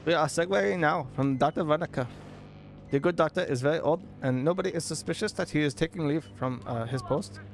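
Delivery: put-on hammy Indian accent; formal address in a mock Indian accent. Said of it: "Should have read that in an Indian accent".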